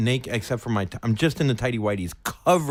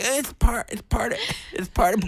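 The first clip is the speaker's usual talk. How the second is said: higher, breathless, strangled half-whisper; choked gasping; 12 semitones higher than usual. Retold or imitated. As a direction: try to speak while being choked. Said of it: "I'm, like, crying".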